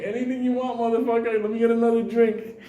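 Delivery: high, odd put-on rooster voice; drunk rooster. Comic voicing the boozed-up rooster to the crowd, high put-on voice. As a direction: play the rooster as a happy drunk. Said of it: "Rooster's like".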